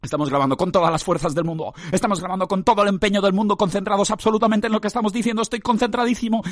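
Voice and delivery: mock intense concentration. Podcaster insisting he is fully concentrated, mock intense. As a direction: pitch climbing into strained insistence.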